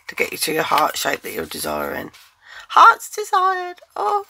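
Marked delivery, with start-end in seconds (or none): 2.7-3.8 high, slow, sweet princess voice; cartoon princess. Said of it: "oh, I sound like a princess". 4.0-4.3 surprised oh. Real, not acted. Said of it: "I sound like a princess".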